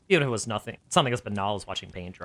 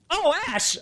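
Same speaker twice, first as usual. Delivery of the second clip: high, sharp surprised yelp; excited yelp; 4 dB louder than usual. Acted. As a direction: yelp it out happily. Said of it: "I yelped back".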